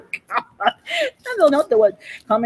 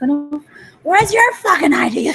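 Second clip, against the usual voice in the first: mock angry demand; 4 semitones higher than usual. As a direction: bark it out like a rude cop, half laughing. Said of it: "And someone's like".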